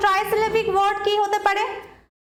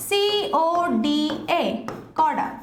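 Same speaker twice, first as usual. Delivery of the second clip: letter by letter, then the word; spelling out letters; 3 semitones lower, slower than usual. Shown as a demonstration. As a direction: spell it for the class. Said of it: "কি বলে থাকি".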